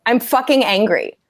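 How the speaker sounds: ordinary talk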